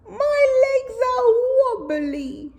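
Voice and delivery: high, slow grumble; grumbling camel. Acted. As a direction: grumble about wobbly legs. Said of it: "she grumbled".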